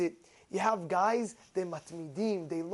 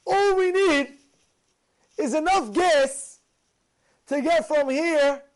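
excited eureka; 7 semitones higher, 5 dB louder than usual. Retold or imitated. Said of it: "He says".

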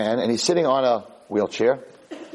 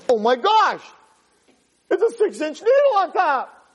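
put-on high, alarmed little-boy outcry; acted shock, pitch leaping way up; horrified shriek. Boy spotting a huge needle, horrified.

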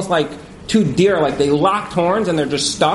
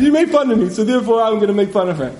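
put-on kid on playground; 2 semitones higher, faster than usual. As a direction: whine like a squabbling kid. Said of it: "like two kids on a playground".

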